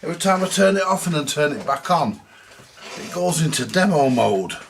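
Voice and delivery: venting real annoyance at a stubborn gadget; exasperated rant. Real, not acted. Repairer grumbling to viewers about his camera, exasperated. Camera keeps switching to demo mode.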